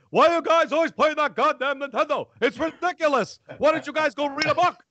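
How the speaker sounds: high, loud mock dad rant; hammed-up angry father voice. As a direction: scold the kids as an exasperated, over-the-top father. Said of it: "when he gets angry".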